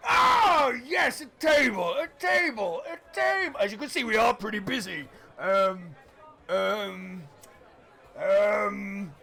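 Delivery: a harried host scrambling for a free table; flustered innkeeper. Acted. GM voices Doncaster, flustered host.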